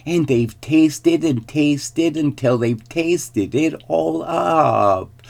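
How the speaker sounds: high, slow, drawn-out squeal; squealing baby bear. A narrator's reading. Baby bear laments his eaten porridge, high squeal. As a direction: stretch the tiny bear's woe. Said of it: "squealed the baby bear".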